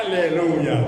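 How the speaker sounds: usual speaking voice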